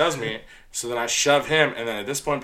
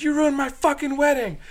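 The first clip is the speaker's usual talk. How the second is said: high-pitched mimicked wail; furious accusation. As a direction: imitate an outraged bride. Bride blaming bouncer.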